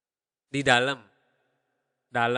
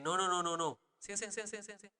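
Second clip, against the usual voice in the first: soft, higher-pitched string of noes; acting out a quick, quiet brush-off; repeated polite refusal; 4 semitones higher, 8 dB quieter, faster than usual. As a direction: rattle off the refusals lightly under your voice. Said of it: "So I'm just saying".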